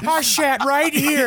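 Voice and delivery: slow, strange put-on man voice; slow odd man voice. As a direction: drag it out in a weird voice. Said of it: "be like".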